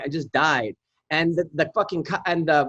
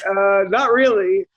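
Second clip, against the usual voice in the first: sheepish admission; 2 semitones higher, 4 dB louder, slower than usual. Retold or imitated. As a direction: confess they have no plan. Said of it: "they were like".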